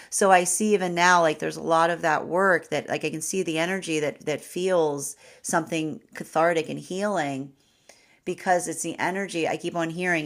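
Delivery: normal talking voice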